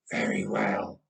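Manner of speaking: a hint of a tired, heavy king; wheezing old king.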